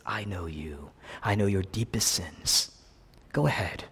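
hushed, close, knowing; quiet voice of Jesus. Retold or imitated. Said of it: "What he's saying is".

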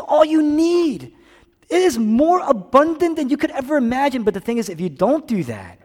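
loud, high-pitched preacher's urgency; impassioned preaching. Real, not acted. Preaches at full force.

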